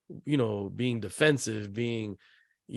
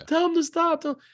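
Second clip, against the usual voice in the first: imitating upset daughter. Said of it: "she'll cover her ears".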